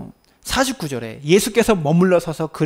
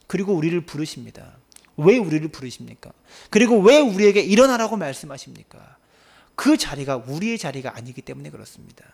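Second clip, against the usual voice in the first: ordinary talk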